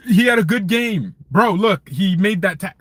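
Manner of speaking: high, loud mock fan protest; mock insistence. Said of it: "People wanna convince me".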